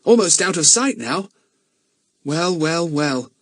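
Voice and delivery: put-on boy accepting they're marooned; wry boy's voice. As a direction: shrug it off wryly. Philip resigned.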